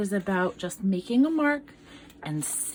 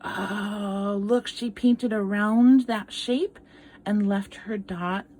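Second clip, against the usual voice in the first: usual speaking voice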